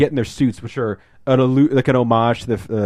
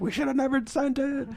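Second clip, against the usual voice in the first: very high, soft strained wail; hammed-up falsetto dismay; mock horror; 8 dB quieter than usual. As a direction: imitate horrified regret in a tiny high voice. Imitating scientists seeing a mangled monkey.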